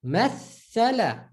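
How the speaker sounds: Mathala, slow, the th doubled and stressed; stressed doubled tha. Shown as a demonstration. Teacher showing the doubled th slowly. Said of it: "Shut down the tha".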